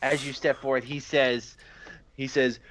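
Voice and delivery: ordinary talk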